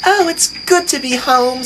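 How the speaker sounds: high, sweet little engine; beaming, innocent and sweet; cherubic Percy. A narrator's reading. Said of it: "said Percy with a cherub smile".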